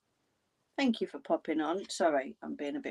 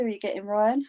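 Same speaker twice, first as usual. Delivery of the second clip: yawning through the word; 4 semitones higher, 6 dB louder than usual. Real, not acted. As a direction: say it mid-yawn. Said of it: "Sorry, I'm yawning".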